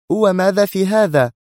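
high-pitched indignant outburst; crying out. A narrator's reading. Sonia leaps from her seat at him, crying out. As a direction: exclaim it high, as a challenge. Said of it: "هبت من مقعدها هاتفة".

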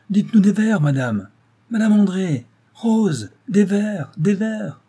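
insistent high calling out; clamouring cries. A narrator's reading. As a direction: shout like an audience demanding a recital. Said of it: "Ce cri fut répété par tous".

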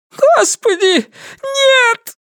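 high squeaky terrified cry; preacher squeaks in terror at the pincers; squeaked. Acted. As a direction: squeak it out in panic. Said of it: "пискнул проповедник".

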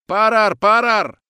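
high, loud, drawn-out command to halt; shouting. A narrator's reading. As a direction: shout after them, stretching each word. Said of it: "закричал Григорьев". (громкий протяжный окрик)

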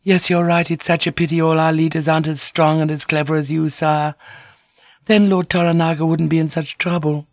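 very high, apologetic lady; submissive, wide-eyed apology; meek, flattering noblewoman. A narrator's reading. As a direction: fawn in a high feminine voice.

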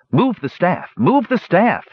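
high, urgent command; urgent cry. A narrator's reading. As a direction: cry out in alarm, pitched well up. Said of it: "he cried".

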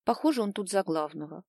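ordinary delivery, nothing marked

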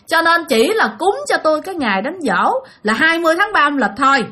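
high-pitched insistent protest; complaining possessing spirit. Retold or imitated. Spirit in the mother corrects the family about his death anniversary, complaining. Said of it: "mẹ của bạn mới nói tiếp".

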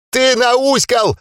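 high, slow wailing accusation; shrill drawn-out yell. Acted. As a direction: wail it slowly, very high. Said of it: "снова завопил гном".

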